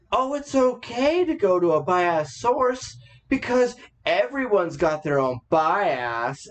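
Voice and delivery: drawn-out mocking smugness, starting high; smug, slow mock justification; snarky, sneering. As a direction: mimic them with a slow, sneering smirk. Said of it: "snarky, sneering smirk".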